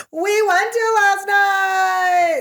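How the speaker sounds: high, very drawn-out comic yell; exasperated shout. Retold or imitated. Telling listeners about the restaurant, exasperated drawn-out yell. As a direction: stretch it into a high mock wail.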